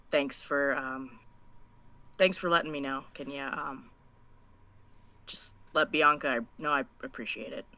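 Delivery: quiet, halting gratitude; reluctant thanks. Acted. Prisoner finding it hard to thank the messenger, quiet and halting. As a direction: force out soft thanks, hesitating between phrases. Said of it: "it was, like, really hard for her to say that".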